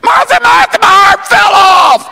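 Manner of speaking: high, loud hammed-up alarm; panicked mock shout. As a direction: holler for Martha in mock panic. Comic acted panic over lost arm.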